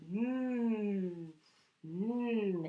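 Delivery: contented mm rubbing the tummy; yummy mm. Shown as a demonstration. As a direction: hum mm as if it's delicious. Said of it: "rub your tummy like".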